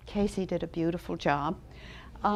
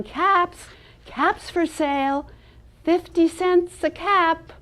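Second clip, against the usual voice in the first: calling out wares; 8 semitones higher, 6 dB louder, slower than usual. Acted.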